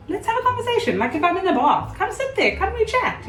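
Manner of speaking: very high-pitched, playful invite; chatty invitation. Acted. Acts out inviting company into bathroom.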